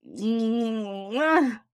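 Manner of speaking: high, drawn-out "mwah"; exaggerated affectionate smooch; big smooching kiss. Real, not acted. Blows friend a kiss.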